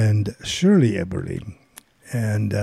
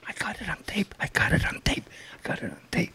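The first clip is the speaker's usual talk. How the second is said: gleeful whisper, barely voiced; excited whisper; 7 semitones higher, 10 dB quieter, faster than usual. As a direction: hiss it out in secret triumph. Whispering gleefully during call.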